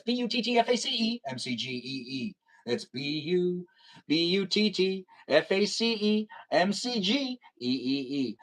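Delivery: slow, drawn-out letter-by-letter spelling. Real, not acted. Streamer spelling out the username for viewers, playful spelling out. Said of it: "It's just fun to spell it out".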